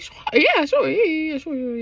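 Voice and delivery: high start, fading into mumbled yeahs; flustered over-agreeing. Retold or imitated. Shane asked if he likes girls, flustered. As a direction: imitate a nervous, unconvincing yes that tails away. Evasive answer about liking girls.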